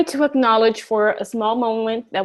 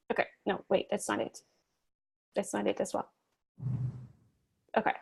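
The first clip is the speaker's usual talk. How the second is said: soft voiceless aside, much quieter; muttering to herself; 3 semitones lower, 12 dB quieter, faster than usual. Real, not acted. Teacher checking her notes aside, soft whispered mutter. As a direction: drop to a whisper under your breath.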